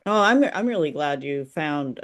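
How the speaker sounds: ordinary delivery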